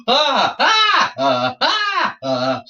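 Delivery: string of very high loud shrieks, settling on the last; repeated screams. Acted. George startled by the screaming platypus, frantic high shrieks. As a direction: panic shrieks one after another. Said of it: "George the Englishman screamed".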